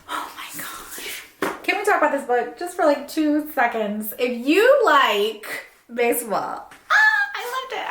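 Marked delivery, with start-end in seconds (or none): 0.1-0.7 breathy whispered aside, barely voiced; whispered oh my gosh. Real, not acted. Vlogger whispering to viewers before her favourite book. 6.9-7.9 high excited squeal on ah, then loved it; gushing. Real, not acted. Reader gushing to viewers about a book she loved.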